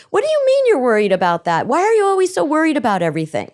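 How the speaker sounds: high, loud, fast scolding; exasperated parent. Retold or imitated. Parent scolding a worried child, high and exasperated. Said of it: "parent scolding worried child".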